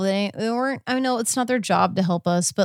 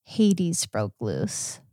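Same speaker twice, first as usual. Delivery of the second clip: prim correction; 3 dB quieter, much slower than usual. Acted. Correcting her husband's swearing, slow mock-prim voice. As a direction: stretch it out to clean up the swear.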